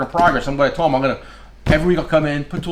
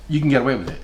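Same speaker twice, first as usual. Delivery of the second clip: ordinary talk, nothing marked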